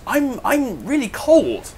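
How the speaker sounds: slow, high, pitiful whimper for Richard; mock shivering complaint. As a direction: play Richard as a pathetic cold wimp. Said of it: "Richard came over to me and says".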